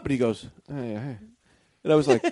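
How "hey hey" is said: very soft, offhand hey; cool, quiet reply. Retold or imitated. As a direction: play it cool, barely audible.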